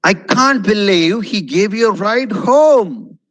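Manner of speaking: demonstrated excitement, swooping up on "home"; excited intonation. Shown as a demonstration. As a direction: stretch it out with swelling delight. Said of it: "There's no excitement in it".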